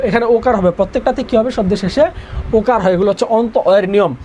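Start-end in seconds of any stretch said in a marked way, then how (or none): none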